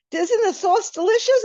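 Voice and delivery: thrilled as sauce flies; giddy delight. Retold or imitated.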